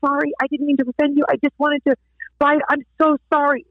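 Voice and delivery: acted contrite pleading; shocked apology. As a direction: apologise as if mortified. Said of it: "I'm shocked".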